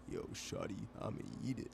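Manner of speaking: low, put-on deep voice; deepened voice. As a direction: drop the voice low and smooth. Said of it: "trying to deepen his voice like".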